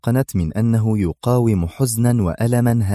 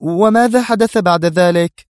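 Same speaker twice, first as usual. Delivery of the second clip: Mona's high, loud, anxious question; urgent alarm in a raised voice; distressed cry; 9 semitones higher, 6 dB louder than usual. A narrator's reading. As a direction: cry out in dismay, pitched well up. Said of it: "فهتفت به منى في جزع".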